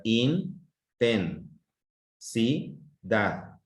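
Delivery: "in, ten, si, da" with pauses between; separated syllables. Shown as a demonstration. Teacher separating a word for pupils, one syllable at a time. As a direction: set each syllable apart as its own beat. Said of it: "Entonces si lo separo".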